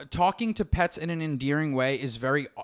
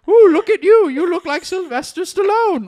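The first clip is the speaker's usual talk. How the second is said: much higher and louder, gushing; gushing impression; 12 semitones higher, 8 dB louder than usual. Mimicking a friend who gushes at a baseball mitt. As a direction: gush over a baseball mitt like it's a darling. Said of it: "impression, uncanny".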